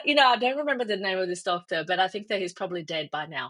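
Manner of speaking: normal talking voice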